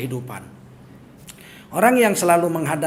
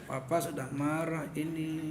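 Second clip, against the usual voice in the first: quiet anger, teeth clenched; 4 semitones lower, 11 dB quieter than usual. Retold or imitated. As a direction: say it softly through clenched teeth, imitating anger. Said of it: "Kalau marah sama anak gitu". (menirukan marah pelan dengan gigi rapat)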